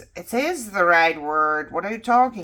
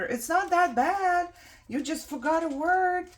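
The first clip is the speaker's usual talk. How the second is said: higher, lightly voiced brush-off; mock dismissive outsider; 10 semitones higher than usual. Speaker mimicking people who downplay word loss, mock dismissive. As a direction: imitate someone shrugging it off. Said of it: "You could say".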